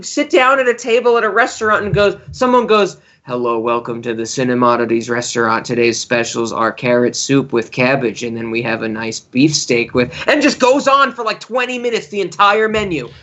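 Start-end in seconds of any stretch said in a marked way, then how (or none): 3.3-10.1 all in one breath. Retold or imitated. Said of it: "read you the entire menu in one breath".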